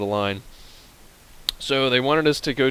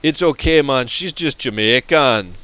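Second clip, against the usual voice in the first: put-on loud, higher Jamaican accent, drawn out at the end; big Jamaican accent, stretching the last words; 4 semitones higher, 9 dB louder, slower than usual. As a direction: imitate a Jamaican local brushing it off. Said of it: "talk in a Jamaican accent".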